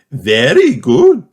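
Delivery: loud, slow praise; Madame Gazelle. Acted. Teacher praises the children, slow. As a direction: praise warmly.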